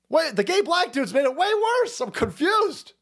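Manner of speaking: high-pitched, bewildered protest; hammed-up high-voiced confusion; mock confusion. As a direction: act out your baffled younger self, voice way up. Acts out his younger self, baffled.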